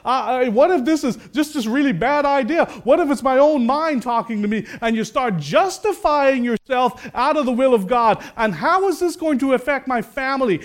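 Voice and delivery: high-pitched, insistent acted worrying; anxious what-ifs. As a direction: pile up doubts, easing slightly at the end. Voices mounting what-if doubts.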